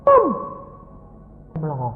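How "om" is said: high-pitched loud call; yelling. Acted. Patient suddenly yells for the driver, high and loud. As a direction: yell out for attention. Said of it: "Terus teriak".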